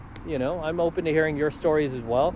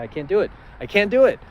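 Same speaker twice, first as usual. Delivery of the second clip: building from plain to a higher, louder plea; insistent refusal; 7 semitones higher, 6 dB louder than usual. Retold or imitated. Son refusing his dad's cash, building louder and higher. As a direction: push the refusal harder each time.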